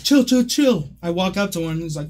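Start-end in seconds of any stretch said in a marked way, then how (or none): 0.0-0.9 higher-pitched, repeated appeal to calm down; retold placating plea. Storyteller voicing a kid backing off, mock pleading. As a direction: plead for a truce in a raised pitch.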